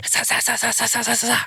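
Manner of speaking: high rapid-fire "sa" hits; imitated sword-combo noise. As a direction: mimic a flurry of strikes.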